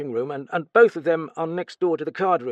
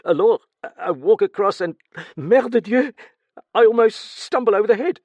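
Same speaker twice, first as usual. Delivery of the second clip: fast, much higher, louder panicky account; frantic fast retelling; 10 semitones higher, 9 dB louder, faster than usual. Acted. Steward reliving finding the body, panicked and fast. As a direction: rush through it, terrified. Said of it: "began to speak very fast".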